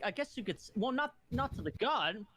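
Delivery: ordinary delivery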